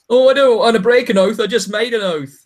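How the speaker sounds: high, loud mock-righteous whine; mocking a pious hero's whine; mocking pious Jon. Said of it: "I get really bored of his pious".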